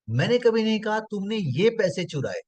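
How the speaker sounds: "ये" punched high before "पैसे चुराए"; single out "ये" with a high push; stress on ये. Shown as a demonstration. Trainer changing the meaning, stress on ये. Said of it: "अलग-अलग तरीके से बोला".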